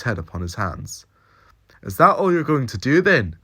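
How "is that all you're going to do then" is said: high, loud exasperated Scottish demand; annoyed thick Scots. A narrator's reading. Jamie snapping at the Doctor in the cell, loud and annoyed. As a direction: thicken the Scots as the irritation boils over. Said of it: "his Scottish accent became thicker as his annoyance grew".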